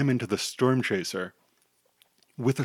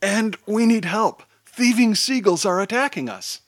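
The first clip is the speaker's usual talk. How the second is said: high-pitched urgent yell; 10 semitones higher, 4 dB louder than usual. A narrator's reading. Hero begs a toddler for help against seagulls, yelling. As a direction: cry for help, pitched well up. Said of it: "Rainbow Chaser yells".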